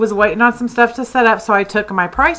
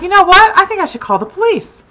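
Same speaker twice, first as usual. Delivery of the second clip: high, louder decision; sudden resolve; 9 semitones higher, 4 dB louder than usual. Retold or imitated. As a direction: announce it like a bold plan. Deciding to call police.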